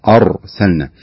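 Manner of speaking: أرسلنا with a full, thick r; heavy r in arsalna. Shown as a demonstration. Sheikh showing callers the right way: أرسلنا with a heavy r. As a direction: say أرسلنا with the r thickened, tongue spread. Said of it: "لاحظ كيف النطق أر".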